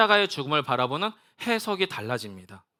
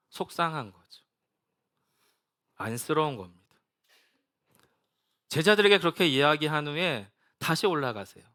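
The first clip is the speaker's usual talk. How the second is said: ordinary talk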